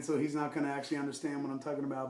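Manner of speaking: ordinary talk, nothing marked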